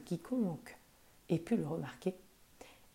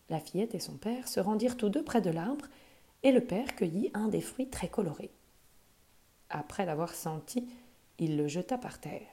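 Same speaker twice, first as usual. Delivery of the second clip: plain reading voice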